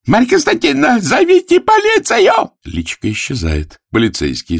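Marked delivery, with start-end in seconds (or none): 0.0-2.5 very high, loud scream; desperate shout. Acted. Arthur, chased by the gamblers, screams desperately for police. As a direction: shriek for help desperately. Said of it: "кричит отчаянно".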